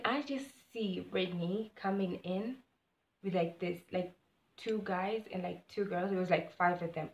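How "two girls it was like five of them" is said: usual speaking voice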